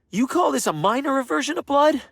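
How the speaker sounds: high, loud incredulous yell; shocked shouting rebuke; outraged shout. Acted. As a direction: call him out, fuming. Outrage after the prank.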